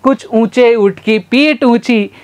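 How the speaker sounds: careful deliberate tongue twister; slow tongue twister. Shown as a demonstration. Teacher slowly demonstrates the camel tongue twister. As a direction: lay out each word slowly. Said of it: "वापस से बोलेंगे".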